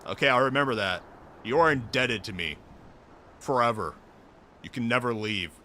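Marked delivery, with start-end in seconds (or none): none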